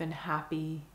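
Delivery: calm meditation guide, long pauses between phrases; guided meditation. Acted. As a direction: guide the listener gently into stillness, unhurried and even.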